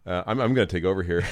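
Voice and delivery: normal talking voice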